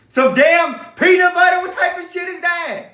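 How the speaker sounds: high, loud indignant shout; real exasperated yell; outraged. Real, not acted. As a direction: yell in disbelief. Outraged at the peanut butter claim.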